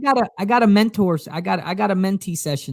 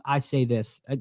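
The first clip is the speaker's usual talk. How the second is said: normal talking voice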